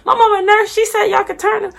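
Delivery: high-pitched tearful whine, imitated; reenacted high, wailing insistence; crying. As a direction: plead through mock sobs. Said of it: "like, crying with my mom".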